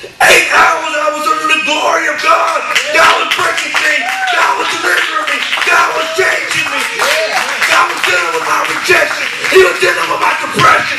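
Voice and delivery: impassioned, shouted testimony; full-force preaching. Acted. Preacher testifying to a congregation how God delivered him, full-force preaching. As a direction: drive each phrase home like a preacher at the climax.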